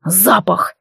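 ЗАПАХ growled with a falling tune; growled exclamation. A narrator's reading. Werewolf blurts out what bothers him, growling. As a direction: growl out the cause, tune dropping. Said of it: "зарычал".

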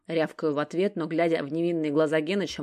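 ordinary delivery

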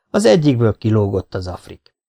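ordinary delivery

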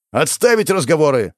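loud barked command; barked order. Acted. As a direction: bark to cut the talk. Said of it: "рявкнул кто-то позади".

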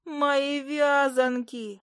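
high, very slow lament; mock-tragic high moan; wailing familiar. A narrator's reading. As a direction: stretch it into a creature's wail. Familiar laments her ruined bundles.

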